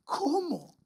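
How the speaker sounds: high, soft, broken ¿Cómo?; anguished cry. Retold or imitated. Young preacher recalling his heartbreak, high and soft. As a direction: ask it like a young man losing his dream. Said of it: "me empecé a llorar".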